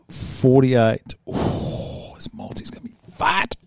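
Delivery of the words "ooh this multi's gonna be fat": whispered, savouring the bet; gleeful whisper. Real, not acted. Gloating to viewers over his fat multi, whispered. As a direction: hush it like a secret treat.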